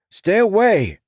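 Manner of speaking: loud, high cry; desperate scream of warning; frantic shout. Acted. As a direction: yell to keep him back. Hero shouts at brother.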